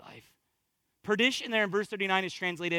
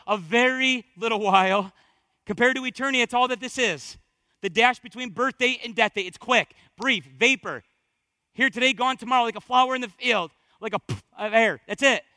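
loud, driving pulpit delivery; worked-up preaching; 4 semitones higher, 7 dB louder than usual. Real, not acted. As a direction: build the urgency like an excited preacher. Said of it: "He's getting worked up".

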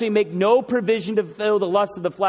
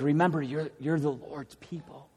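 soft, pleading reminder, fading quieter; beseeching plea; 3 semitones lower, 8 dB quieter than usual. Pretend. Jesus pleading with his people, soft and beseeching. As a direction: beg them gently to remember. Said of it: "in a beseeching, begging way".

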